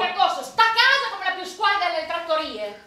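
angry reproach. Acted. Wife hurling grievances at her neglectful husband, growing angry.

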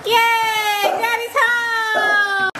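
very high, slow cheering call; excited cheer. Real, not acted. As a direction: celebrate Daddy's arrival. Cheering to dogs.

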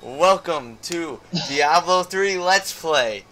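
normal talking voice

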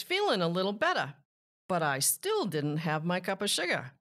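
put-on lively storybook read-aloud for kids; expressive picture-book narration; reading to children. A narrator's reading. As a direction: tell it like a sly wolf talking to little listeners.

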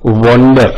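વન્ડર said "WON-der", as in wander; "o" vowel in વન્ડર. Shown as a demonstration. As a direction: round the first vowel of વન્ડર to "o". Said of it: "ત્યાં ઓ ઉચ્ચાર થાય છે".

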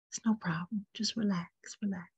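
the same words repeated in a soft whisper; whispered reassurance. Acted. Guide soothing a nervous first-timer, whispering. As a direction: echo the comfort as a whisper.

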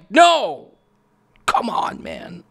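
frustrated gamer scream; anguished yell. Real, not acted. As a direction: cry out as the game is lost. Crashes into a pipe.

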